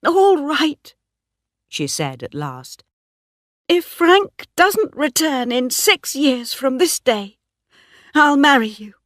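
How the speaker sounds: Bathsheba giving way; reluctant yielding. A narrator's reading. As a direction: give in because there's no way out. Bathsheba gives in.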